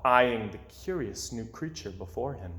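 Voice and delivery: plain reading voice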